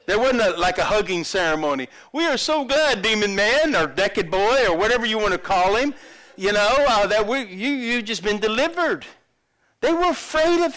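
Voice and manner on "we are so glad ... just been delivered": high, loud mock welcome; gushing welcome. Crowd greeting the delivered man, gushing. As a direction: gush loudly over the newcomer.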